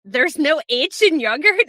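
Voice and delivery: incredulous. Retold or imitated. Scot learning about American spelling, incredulous. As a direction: ask it slowly, loudly, as if astounded. Retells own shock at discovery.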